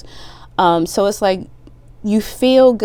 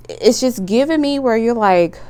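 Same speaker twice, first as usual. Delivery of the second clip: ordinary talk, nothing marked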